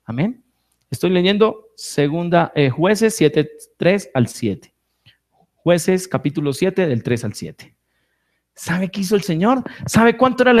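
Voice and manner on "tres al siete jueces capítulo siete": ordinary talk, nothing marked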